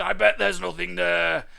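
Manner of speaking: scornful dinosaur dad; scoffing. A narrator's reading. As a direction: dismiss it with loud bravado. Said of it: "and scoffed".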